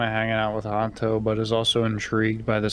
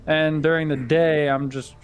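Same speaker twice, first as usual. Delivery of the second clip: a touch higher and louder, slower on the opening; lightly voiced character; 6 semitones higher, 3 dB louder than usual. Player voicing a cover story to the table, lightly in character. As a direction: lightly play the character explaining a routine.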